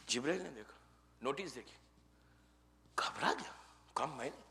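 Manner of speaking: very soft, low storytelling with long pauses; quiet, halting dramatic narration; hushed suspense. A narrator's reading. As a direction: drop almost to nothing as the tale turns tense. Suspense as Gabriel reads the notice.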